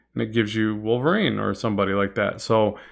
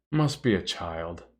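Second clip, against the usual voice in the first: lower, softer and slow, like a calm parent; calm parental voice; 6 semitones lower, 6 dB quieter, slower than usual. Retold or imitated. Streamer echoing a chat message as a calm parent. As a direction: say it like an unbothered parent reading a text. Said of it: "in a very parental text voice".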